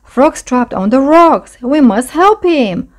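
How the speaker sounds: high, urgent plea; crying out. A narrator's reading. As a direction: raise the alarm and urge a rescue. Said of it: "he cried out".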